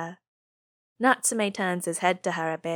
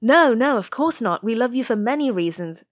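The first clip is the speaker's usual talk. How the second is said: flustered; 5 semitones higher, 6 dB louder than usual. A narrator's reading. Nishimura hastily backpedals.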